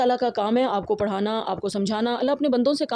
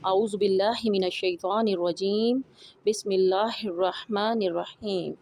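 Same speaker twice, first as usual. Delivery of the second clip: recitation. Real, not acted. Teacher recites before lesson.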